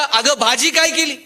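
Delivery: high, loud call from the kitchen door. Acted. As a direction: call insistently to your wife. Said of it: "किचनच्या दारात गेला".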